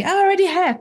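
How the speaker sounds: mock protest, pitched right up; protesting dad. Dad answers photographer's order to breathe in, mock protest. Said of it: "And they just look at me".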